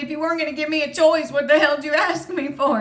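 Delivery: high, fast, sassy comeback; feisty retort. Retold or imitated. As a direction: fire it back with cheek. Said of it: "You're a feisty little one".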